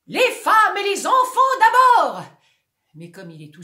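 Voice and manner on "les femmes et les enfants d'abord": high, loud captain's cry; shout. Acted. Tilting actor crying the shipwreck order. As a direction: bellow the order. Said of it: "Il a envie de crier".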